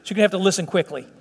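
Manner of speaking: fast, hurried delivery; quick preacher pace; speaking quickly. Real, not acted. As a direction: rattle it off to save time. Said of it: "I'm speaking up a little quicker".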